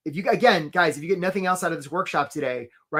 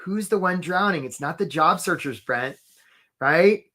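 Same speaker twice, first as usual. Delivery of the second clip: ordinary talk, nothing marked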